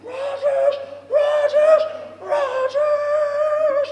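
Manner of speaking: high, loud excited screams; screaming the name. Retold or imitated. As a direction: yell it down the hallway with all he has. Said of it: "just screaming as loud as he could".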